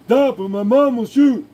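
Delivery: high loud mock kid threat; high loud comic threat; put-on kid threat. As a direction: yell it like a bratty kid. Put-on movie voice.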